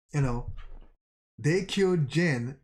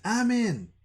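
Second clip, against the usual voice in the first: high call of a name, mildly projected; 9 semitones higher than usual. A narrator's reading. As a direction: call down to a comrade, pitched high. Calling down to screaming comrades.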